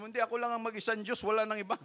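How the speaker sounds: high, fast insistent voicing of God. Preacher quoting God himself, high fast insistence. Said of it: "sabi nga ng Diyos mismo".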